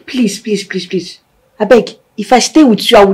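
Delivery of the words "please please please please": whispered begging, very soft; pleading whisper. Acted. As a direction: beg him under your breath. Wife begging husband to let her go.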